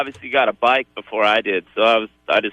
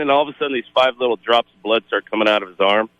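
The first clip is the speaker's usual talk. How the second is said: ordinary talk, nothing marked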